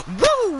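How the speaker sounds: high ringing woo as a signature cry; a loud, high Nature Boy woo; Flair woo. Acted. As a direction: throw in a sharp falsetto woo. Voicing Flair in a promo.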